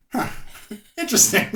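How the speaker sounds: high, loud laughing "interesting"; wry laugh. Retold or imitated. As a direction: break into a laugh on the word. Repeated wry reaction, laughing.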